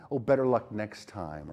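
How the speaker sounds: low, slow, patronizing consolation; mock condescending. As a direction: imitate a condescending parent, dragging it out. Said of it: "avoid things that are condescending".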